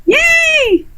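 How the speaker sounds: a quick high cheer of delight; excited cheer. Real, not acted. Friend cheering the artist on to draw them, high excited yay. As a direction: cheer her on, bright and happy.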